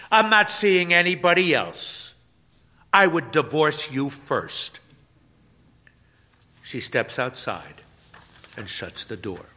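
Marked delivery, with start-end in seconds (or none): 0.1-4.8 higher, louder, pressing the point; insistent denial. Acted. Wife insisting to her husband she's faithful, louder and higher. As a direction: push harder the second time. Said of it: "she turns and says".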